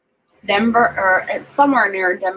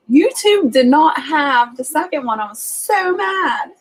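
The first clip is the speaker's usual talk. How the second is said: indignant whine; 6 semitones higher, 4 dB louder than usual. Real, not acted. Indignant that YouTube lacked movie.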